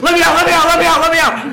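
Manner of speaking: high, loud panicked cry to be let out; panicked plea. Retold or imitated. Claustrophobic friend trapped in the ride, high panicked shouting. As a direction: scream it like you're trapped in a tube. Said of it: "Scotty, like, flips out".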